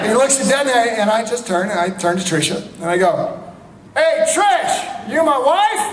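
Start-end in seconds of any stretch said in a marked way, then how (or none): 4.0-5.9 high shouted question across a room; calling out. Retold or imitated. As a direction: call out to her far across the party. Calling across party to actress.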